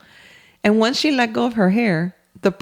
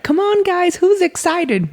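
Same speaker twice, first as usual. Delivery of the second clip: high-pitched, hyped-up dad voice; rally the group with mock excitement, pitched way up; excited dad; 10 semitones higher than usual. Dad rallying the kids at a theme park, excited. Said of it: "So he's like".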